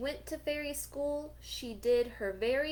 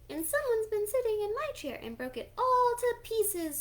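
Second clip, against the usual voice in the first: put-on higher, louder baby bear squeal; wee little voice; 12 semitones higher, 5 dB louder than usual. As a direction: squeal like a tiny cub whose chair broke. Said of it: "squealed little Baby Bear in his wee little voice".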